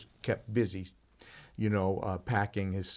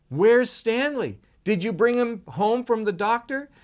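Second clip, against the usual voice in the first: put-on anxious child; 11 semitones higher, 7 dB louder than usual. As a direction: demand an answer like a frightened boy. Boy questions his parents.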